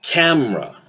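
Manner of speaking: CAMERA as CAM-ra; two-syllable CAMERA, high on CAM then falling; reduced vowel. Shown as a demonstration. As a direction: drop the middle vowel of CAMERA. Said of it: "will actually sound".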